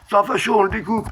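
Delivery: high-pitched mock outcry; high, excited accusing voice; mock denunciation. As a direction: pipe up like an accusing mob. Mimics crowd denouncing a fascist.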